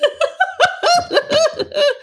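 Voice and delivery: a long high-pitched laugh. Real, not acted. Amused by a viewer's comment in chat, high laugh. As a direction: let a high laugh roll out.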